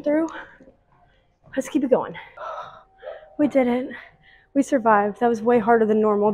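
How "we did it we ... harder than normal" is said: loud, breathless relief after finishing; relieved and excited. Real, not acted. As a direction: celebrate surviving the workout. Just finished hard treadmill workout.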